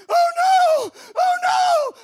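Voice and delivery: high, loud mock panic; panicked. Storyteller sinking in mud, high loud mock panic. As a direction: shriek it like the mud has you.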